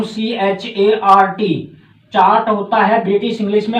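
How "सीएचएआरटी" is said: सीएचएआरटी spelled letter by letter, very slowly; spelling out. Shown as a demonstration. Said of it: "जैसे उनका मैं सिखाता हूँ". (सीएचएआरटी अक्षर-अक्षर धीरे-धीरे बोलें)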